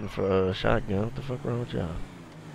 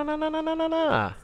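panicked high no. Real, not acted. NPC running off in game.

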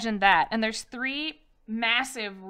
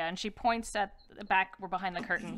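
normal talking voice

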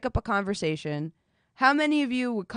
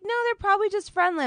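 higher, quick imitation of her friend; mimicking her friend's innocent reply, pitched higher; mimicking Brownie; 12 semitones higher, faster than usual. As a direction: chirp it up high like the naive friend. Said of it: "And Brownie was like".

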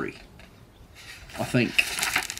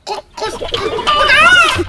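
high clucks building to a loud squawk; chicken squawk; 6 dB louder than usual. A narrator's reading. Chicken answering Duck, clucks into loud high squawk.

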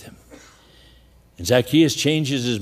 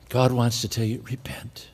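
soft whisper, almost no voice; hushed pleading whisper; whispered appeal; 4 dB quieter than usual. Real, not acted. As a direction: lean in and breathe the word repent.

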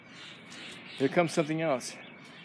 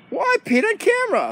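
high put-on dog; embarrassed dog voice; 3 dB louder than usual. Dog caught peeing on video, high mock dismay.

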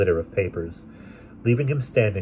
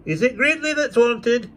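angry; 4 dB louder than usual. Acted. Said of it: "with an angry stare".

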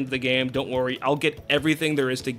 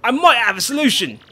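put-on loud, hearty old deckhand call; gruff sailor Pa-Patch; 5 semitones higher, 5 dB louder than usual. Sailor calling to Flavio, loud and hearty. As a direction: bellow across the harbor like a sailor.

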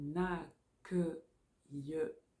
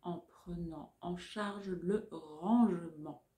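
dictating. Shown as a demonstration. Dictation chunks.

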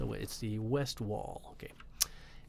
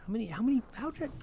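high disbelief; 12 semitones higher, much faster than usual. Real, not acted. Disbelief at missed medallions.

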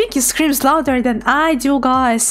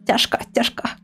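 whispered; 5 semitones lower, 5 dB quieter, faster than usual. Real, not acted. Sighing over expensive models.

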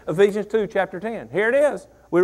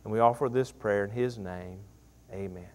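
soft, low prayer voice trailing into amen; closing prayer; 8 semitones lower, 6 dB quieter than usual. Acted. Pastor closing prayer to the church, soft and low. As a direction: pray quietly before the congregation.

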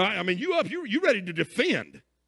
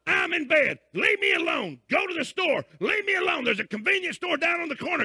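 annoyed yelling; 5 semitones higher than usual. Retold or imitated. Sleeper refusing the knocking neighbour from bed, fed-up yelling. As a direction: keep shouting him away from the door.

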